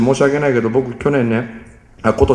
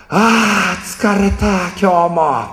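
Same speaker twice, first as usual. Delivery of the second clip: drawn-out exhausted sigh, slowed down; weary groan; 5 semitones higher, much slower than usual. Retold or imitated. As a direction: droop like a worn-out parent after work. Eltern, erschöpft, beispielhaft vorgespielt.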